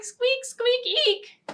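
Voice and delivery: high squeaky mouse voice; mouse squeaks. Acted. The mouse flying out of the mitten, squeaking high.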